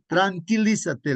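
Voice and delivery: Jesus calming the sea, slow firm command. Retold or imitated. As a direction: draw out the order to the sea. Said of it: "al mar le dice".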